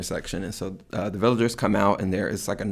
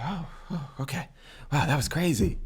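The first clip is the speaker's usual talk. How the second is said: dazed man waking; 5 semitones higher, 6 dB quieter than usual. Retold or imitated. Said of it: "he's like".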